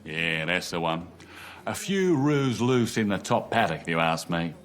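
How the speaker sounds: hammed-up Australian accent. Husband playing an Aussie stranger for his wife, hammed-up accent. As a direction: ham up a bad Australian accent. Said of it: "What accent's that meant to be?".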